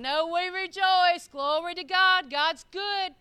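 high, loud jubilant declaration; rejoicing spirit. Retold or imitated. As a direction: proclaim it joyfully, drawing out the words. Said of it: "your spirit is saying".

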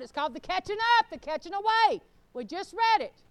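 high, loud, driving sermon delivery; full-force preaching. Real, not acted. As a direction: preach it hard to the whole congregation.

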